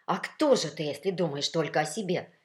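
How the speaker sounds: insistent, reproachful question; needling a selfish rival; persistent scolding. A narrator's reading. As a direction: keep pressing the accusation. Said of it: "не унимался баритон".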